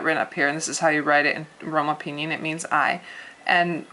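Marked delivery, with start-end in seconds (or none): none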